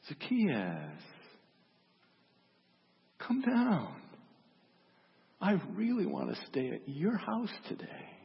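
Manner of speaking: completely different tone. Acted. Jesus calls Zacchaeus down from the tree, in a changed tone. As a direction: call him down with long pauses between phrases. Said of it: "in this completely different tone of voice".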